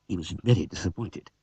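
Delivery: ordinary delivery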